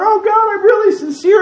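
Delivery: high, hammed-up pious plea; mock sincere plea. Unfaithful worshippers protesting to God in a high mock plea. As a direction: play the faker swearing he means it. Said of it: "And they're going".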